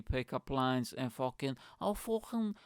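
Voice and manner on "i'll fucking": high, drawn-out Scouse chat-up; comic Scouse lad, slow and high; Scouse accent. Acted. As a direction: put on a high Scouse voice for a cheesy come-on. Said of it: "why is everything in Scouse accent".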